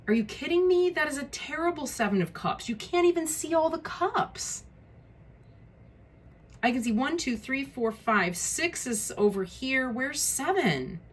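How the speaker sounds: can't believe how bad this card looks; real frustration. Real, not acted. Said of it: "you see my frustration with this".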